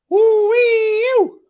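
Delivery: loud, high, long swooping whoop. Real, not acted. Fan reacting to a near miss, loud whoop. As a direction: let out a rising and falling "woo".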